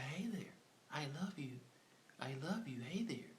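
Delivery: high, soft sweet-talk to a bear; mock cooing, Tim calming the grizzly; tender coaxing. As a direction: coo it like soothing a pet. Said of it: "He said".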